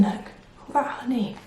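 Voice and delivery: plain reading voice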